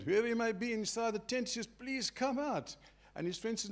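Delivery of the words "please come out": pleading. Acted. Man coaxing sick friend from tent.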